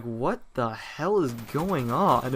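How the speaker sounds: very slow, word-by-word bewilderment; baffled disbelief. Retold or imitated. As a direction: drag out every word, completely lost. In shock at clown's gift.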